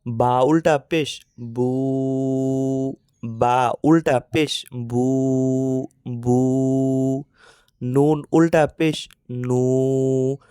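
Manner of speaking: very slow, each বু নু ইউ held long; slow teacher's drill, vowels stretched; slow drawn recitation. Shown as a demonstration. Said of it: "আমরা মস্ক করি".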